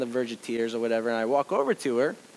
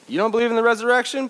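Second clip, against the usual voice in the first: higher, louder, fast incredulous scoff; mock scornful God; 9 semitones higher, 6 dB louder, faster than usual. Imagined disapproving God to doubter, fast scornful scoff.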